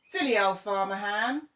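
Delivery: loud sing-song jeer; jeering shout. Acted. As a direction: crows mocking the scarecrow's owner.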